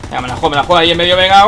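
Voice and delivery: fast, loud, pumped; rushed excited gamble call; hyped rush. Real, not acted. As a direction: psych yourself up fast and loud. Gambling on a risky move.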